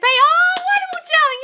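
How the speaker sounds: very high, loud squeal of delight; delighted cheer. Real, not acted. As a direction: gush with real joy for a friend. Cheering a viewer's manifested job.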